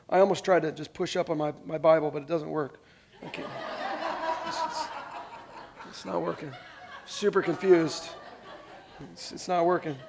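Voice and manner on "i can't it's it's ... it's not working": laughing at himself. Real, not acted. Fumbling with his Bible.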